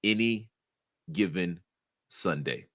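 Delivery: weighty, spaced-out title reveal with a pause after each word. Shown as a demonstration. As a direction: intone it solemnly, word by word, dropping low at the end. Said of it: "let me say it with more gravitas".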